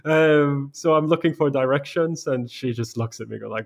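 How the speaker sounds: normal talking voice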